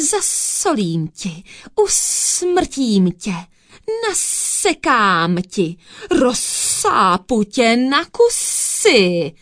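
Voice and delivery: slow threats with long hissed s; spitting, hissing threats. A narrator's reading. Cat clawing the thief in the tree, hissing menacingly. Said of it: "prskala přitom a syčela".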